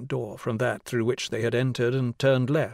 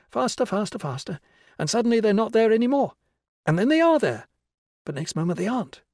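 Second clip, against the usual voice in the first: high, animated schoolmistress building excitement; teacher leading pretend game; 6 semitones higher, 3 dB louder than usual. A narrator's reading. Teacher conjuring birds for children, animated high voice. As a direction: lift the pitch higher as the birds multiply.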